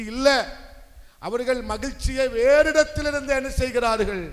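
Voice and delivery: loud, high rhetorical question; forceful preaching. Real, not acted. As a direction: throw the question out to the crowd. Preacher throws question at congregation. (உரத்த குரலில் கேள்வி)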